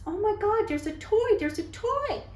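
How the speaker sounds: put-on high, thrilled child's voice; imitating her son's excited cry; kid's excited squeal. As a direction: squeal it like a six-year-old spotting a toy.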